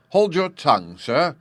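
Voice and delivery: plain reading voice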